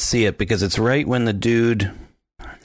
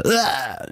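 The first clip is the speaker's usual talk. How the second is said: higher mock yelp on hitting a desk; imitated impact grunt; 6 semitones higher than usual. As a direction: grunt as if slammed into furniture. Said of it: "as he hits the desk".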